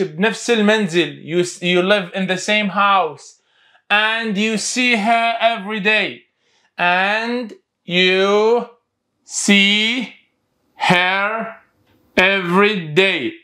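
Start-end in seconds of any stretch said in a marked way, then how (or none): 1.3-6.2 very slow reading; slow model reading. Shown as a demonstration. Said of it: "تعالوا وياي". 6.8-13.3 a pause after each word. Shown as a demonstration.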